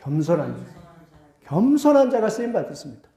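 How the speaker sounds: usual speaking voice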